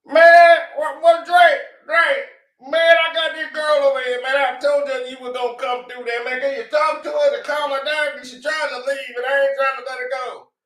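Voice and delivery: hammed-up father begging his famous son; pleading dad. Father begging [name] on the phone, high mock shout.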